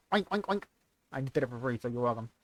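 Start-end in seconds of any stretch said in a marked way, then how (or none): 0.1-0.6 higher-pitched, put-on pig oinks. Shown as a demonstration. Streamer demos animal noises for donors, pig oinking. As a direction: oink like a pig. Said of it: "moo, or oink".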